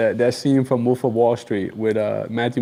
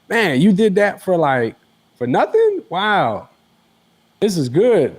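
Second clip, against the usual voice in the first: high amazed voice; amazed and impressed, pitched up; 7 semitones higher, slower than usual. Retold or imitated. Imitating impressed clients.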